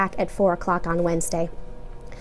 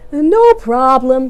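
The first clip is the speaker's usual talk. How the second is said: sheepish; 4 semitones higher, 9 dB louder, much slower than usual. A narrator's reading. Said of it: "Carl said sheepishly".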